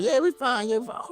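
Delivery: high, overly chirpy voice; faked reassurance. A frightened wife answering the hosts through the door, fake cheerful and high. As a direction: squeak out a false all-is-well. Family faking calm behind door.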